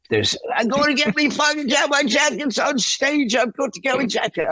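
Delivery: put-on high, shouted drunken rant. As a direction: bellow like a drunk drummer hunting his jacket. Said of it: "I'm not doing it that great".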